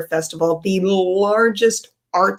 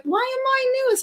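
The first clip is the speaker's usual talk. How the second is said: much higher, puzzled mom voice; baffled mother; 4 dB quieter than usual. Retold or imitated. Mother bewildered to learn she is in the newspaper. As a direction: squeak it out, bewildered.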